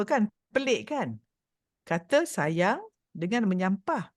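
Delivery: normal talking voice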